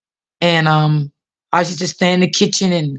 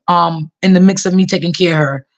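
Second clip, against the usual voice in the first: ordinary delivery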